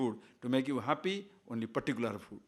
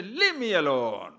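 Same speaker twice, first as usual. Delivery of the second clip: acted exasperation, drawn-out and louder; exasperated outburst; 5 dB louder, slower than usual. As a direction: push the demands away, stretching the words out. Acting out reply to endless demands.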